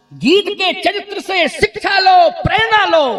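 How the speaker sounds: high, loud preacher's cry; full-force rallying call; shouted exhortation. Real, not acted. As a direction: shout it out to rouse the congregation.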